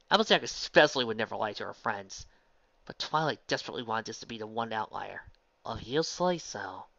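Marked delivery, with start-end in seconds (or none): 5.6-6.9 slow, fading doubtful reply; trailing off. Acted. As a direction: let the words trail away. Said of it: "Applejack trailed off".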